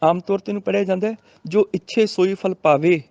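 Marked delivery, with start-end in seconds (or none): 1.4-3.0 ਇੱਛੇ and ਪਾਵੇ end in closed "ay"; common misreading. Shown as a demonstration. Teacher shows the common reading, ਇੱਛੇ ending in e. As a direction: read ਇੱਛੇ and ਪਾਵੇ with narrow e. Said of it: "ਗਲਤ ਹੋ ਗਿਆ".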